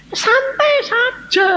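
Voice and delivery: high, slow, stretched-out words; exaggeratedly relaxed. Brother answering his panicking siblings, exaggeratedly relaxed. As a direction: draw it out lazily, high and carefree. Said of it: "Saya santai saja".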